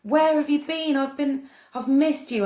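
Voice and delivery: begging a lost aunt, half in tears; retold tearful plea. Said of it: "And I was crying".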